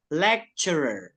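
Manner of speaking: "Lecturer" slowly as LEK-cher-er. Shown as a demonstration. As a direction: model "Lecturer" with a clear K and CH. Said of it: "T-nya kita baca C". ("Lecturer" pelan, dibaca lek-cer-er)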